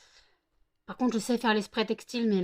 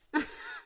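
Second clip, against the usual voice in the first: a sudden high-pitched laugh; high squeal laugh. Real, not acted. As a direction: burst into a squeaky giggle. Laughs at the puppy.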